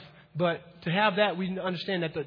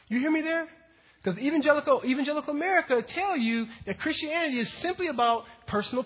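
higher, louder preaching; full-force preaching; 4 semitones higher, 3 dB louder than usual. Acted. Pastor presses the congregation, full-force preaching. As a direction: drive it home to the church.

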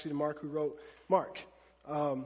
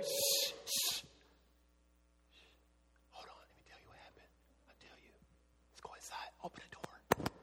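hushed urgent whisper; 10 dB quieter than usual. Acted. Peter hushing the crowd at the gate, urgent whisper. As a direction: hush them so nobody hears. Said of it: "Be quiet".

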